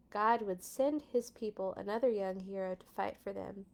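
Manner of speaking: ordinary delivery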